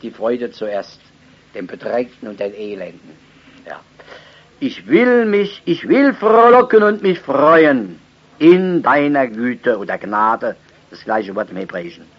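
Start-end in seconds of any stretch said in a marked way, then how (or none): 4.6-9.8 louder, higher proclamation of the verse; preaching at full force. Acted. Preacher urging the church to rejoice, loud and high. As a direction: lift it up like a banner of joy.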